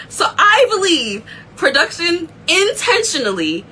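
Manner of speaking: normal talking voice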